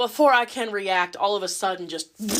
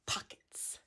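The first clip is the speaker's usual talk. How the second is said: whispered command; 19 dB quieter than usual. Retold or imitated. Doctor demands pockets.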